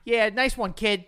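cocky Boston tough guy; hammed-up Boston accent. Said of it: "Boston accent".

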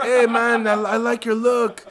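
loud, high, overfriendly hustler voice; a stranger hustling the tourist, loud mock-friendly; imitating pushy stranger. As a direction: play the pushy street guy greeting, high and loud. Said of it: "the guy, he's like".

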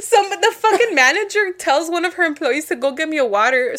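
normal talking voice

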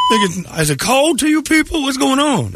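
mock kid voice asking what's going on; imitating a kid. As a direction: pitch way up to mimic the young fan. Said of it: "and he goes".

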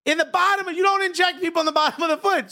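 high-pitched comic outrage; indignant. Acted. Patient appalled at an injection in the foot, indignant.

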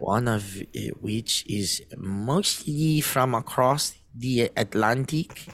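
slow, deliberate mock German accent; halting attempt at German accent. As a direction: put on a German accent, dragging the words. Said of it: "Do it in a German accent".

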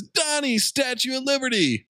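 high, playful call; high goofy turtle call; Mikey voice. Acted. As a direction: call out to his brother for the throw. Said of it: "Mikey says".

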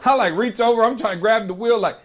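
ordinary talk

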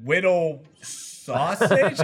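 "Whittle... Sausage" split by a puzzled pause; halting announcer reading an odd name; hesitant name reading. Retold or imitated. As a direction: read out "Whittle", stop, then "Sausage". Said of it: "Jack was reading all the names".

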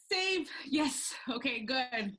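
loud, high, emphatic exclamations; re-enacted burst of enthusiasm; excited approval. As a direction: punch each word with delighted approval. Retold delight at finding a post.

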